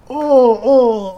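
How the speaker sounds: loud sudden pained oh; pained groan. Real, not acted. Gamer groans sitting on painful chair, pained oh. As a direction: groan at the sore seat.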